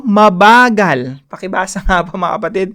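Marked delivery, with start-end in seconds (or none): 0.1-1.2 slow, emphatic "mabagal"; drawn out slowly. Shown as a demonstration. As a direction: stretch "mabagal" out, louder. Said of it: "Ang ganda po, oh".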